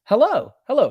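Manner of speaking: a real startled hello; surprised hello. Real, not acted. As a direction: greet it like a surprise. Greeting the chart move.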